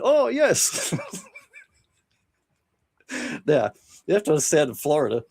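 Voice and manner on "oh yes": high laughing yes. Retold or imitated. Rookie laughing along with Terry's naked prank, high and gleeful. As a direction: laugh it out, thrilled by the dare. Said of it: "He laughed and went".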